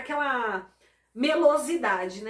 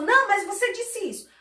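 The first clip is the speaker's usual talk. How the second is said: higher, louder insistent accusation; mock argument; 7 semitones higher, 5 dB louder than usual. Offended spouse insisting during a fight, mock heated. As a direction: push back hard in a staged quarrel. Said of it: "Aí a pessoa".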